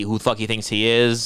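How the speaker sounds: ordinary delivery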